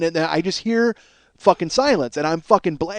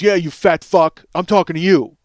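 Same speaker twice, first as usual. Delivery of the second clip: angry call-out; 7 dB louder than usual. Retold or imitated. Karaoke host singling out a man at the bar, angry call-out. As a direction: imitate an angry man calling someone out.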